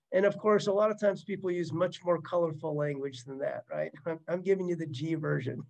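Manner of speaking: usual speaking voice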